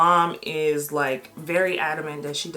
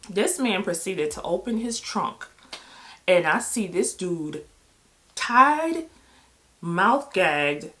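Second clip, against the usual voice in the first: usual speaking voice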